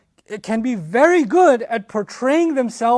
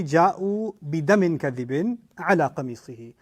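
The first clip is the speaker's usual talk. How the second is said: verse recitation; 4 semitones lower, much faster than usual. Real, not acted.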